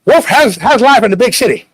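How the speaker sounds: loud, high hollering cousin impression; hammed-up country cousin greeting. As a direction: holler it like a rowdy country relative. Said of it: "my cousin Muff always says".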